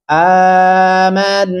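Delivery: आमन with a long held opening aa; आमन drawn out, teacher's model reading; long vowel. Shown as a demonstration. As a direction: stretch the first vowel of आमन slowly. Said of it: "उसको लंबा करेंगे".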